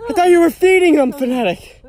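high, loud accusation; winded, exasperated outburst; exasperated reproach. Real, not acted. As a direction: complain incredulously to a friend who slipped up. Reproaches friend after the escape.

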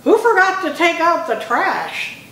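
high, loud heckle; yelled jeer. A narrator's reading. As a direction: yell it out over the playground. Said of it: "Somebody yelled".

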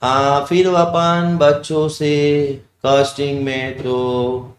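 imitating a slow, drawn-out elderly caller; slow imitation of the 55-year-old's tone; slow old man's tone. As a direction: mimic his slow, low-key way of talking. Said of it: "ये tone ऐसे धीरे में".

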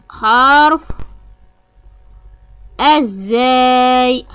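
measured alphabet drill, حرف lifted before each stretched name; careful letter names. Shown as a demonstration. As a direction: model الباء, التاء, الثاء with long held vowels and pauses. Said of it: "وننتبه لنطق اسم الحرف بصورة صحيحة".

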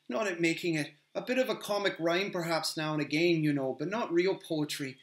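put-on an earnest young hobbit gushing about the Lady; Sam, eager hobbit. Sam voiced, higher, eager.

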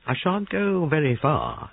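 high, slow, lingering words; pointed meaning. A narrator's reading. Christopher reassuring Molly as he leaves, pointedly. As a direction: draw it out with definite meaning. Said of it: "held a very definite meaning".

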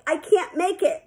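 ordinary delivery, nothing marked

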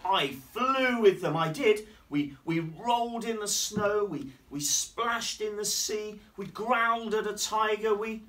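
put-on high, loud excited child recounting; Lulu. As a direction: gush it out, stretching the action words. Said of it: "Lulu's telling Daddy what happened".

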